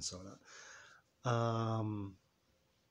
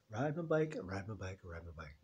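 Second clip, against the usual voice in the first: each repeat softer until almost gone; fading repetition; 7 semitones lower, 10 dB quieter than usual. Shown as a demonstration. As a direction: let it trail off like pedalling away.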